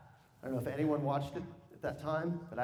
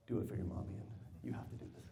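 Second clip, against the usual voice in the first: lightly voiced stepdad, lower and softer; a hint of a stepfather pressing him quietly, lower; half-whispered mock stepdad, lower pitch; stepdad's urging; 8 semitones lower, 9 dB quieter, faster than usual. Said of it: "He's like".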